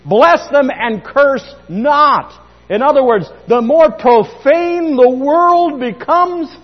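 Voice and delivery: high, loud, slow proclamation; fervent preaching. Acted. Preacher urging the church to bless, not curse. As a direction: drive each word home from the pulpit.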